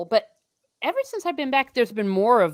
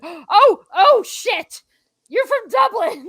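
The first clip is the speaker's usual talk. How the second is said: shocked realisation; 11 semitones higher, 8 dB louder, slower than usual. Real, not acted. Realises the viewer is from Dublin.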